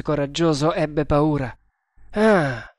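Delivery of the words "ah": through clenched teeth. A narrator's reading. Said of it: "esclamò coi denti stretti".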